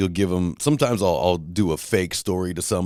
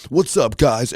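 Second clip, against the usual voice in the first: mock hyped YouTuber "What's up, guys?", much higher and louder; YouTuber greeting; 8 semitones higher, 6 dB louder, slower than usual. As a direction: ham up the big YouTube intro. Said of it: "Every episode".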